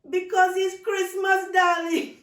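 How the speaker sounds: loud, high laughing explanation; laughing aunt. Acted. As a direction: burst out laughing at the misunderstanding.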